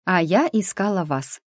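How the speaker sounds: ordinary delivery, nothing marked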